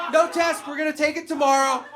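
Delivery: loud, high, giving up; defeated teacher. Retold or imitated. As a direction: concede the class is lost. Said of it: "He's like".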